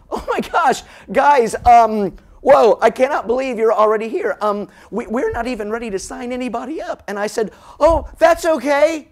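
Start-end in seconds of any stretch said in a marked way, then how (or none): 0.1-7.0 loud, high-pitched startled outburst; flustered astonishment. Retold or imitated. Startled man at sign-up reacts. 7.8-9.1 loud, high, drawn-out singsong; breezy reassurance. Retold or imitated. Dad breezily brushes off the official.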